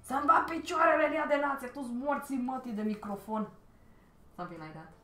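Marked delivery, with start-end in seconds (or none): 0.0-3.5 loud furious Romanian cursing; angry Romanian swearing. Retold or imitated. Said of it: "I would sound like".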